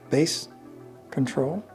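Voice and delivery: usual speaking voice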